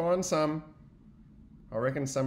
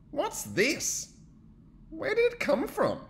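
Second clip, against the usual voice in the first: curious surprise; 4 dB louder, slower than usual. Acted. Bear finds backpack.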